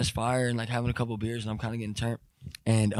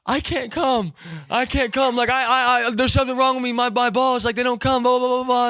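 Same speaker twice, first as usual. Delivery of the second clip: panicky whine; 12 semitones higher, 3 dB louder than usual. Retold or imitated. Panicked teen pleads with dad.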